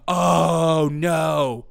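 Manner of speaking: loud fake-shocked oh no; mock gasp. Streamer feigning shock at Christie's non-endorsement. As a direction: gasp in hammed-up horror.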